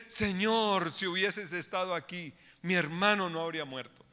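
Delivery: soft, sorrowful complaint to Jesus; quietly mourning her brother; grieving reproach. Retold or imitated. Martha softly grieving to Jesus. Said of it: "el tono de voz, la acción en la cara de María es diferente".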